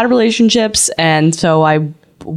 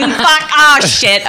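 higher, flustered swearing; mock panic; 6 semitones higher, much slower than usual. Host acting out blurting love on the phone, mock panic. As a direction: blurt it as a comic slip.

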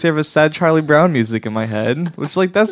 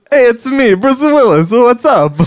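much higher, louder put-on movie star voice; Christopher Walken voice; 11 semitones higher, 7 dB louder, slower than usual. Explaining the joke to friends, a high loud Walken-style voice. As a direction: ham up a Walken-ish tough guy. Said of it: "What I did was".

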